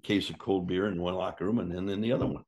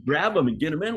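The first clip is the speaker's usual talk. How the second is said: urgent order; 8 semitones higher, 6 dB louder than usual. Retold or imitated. Pilot ordering them.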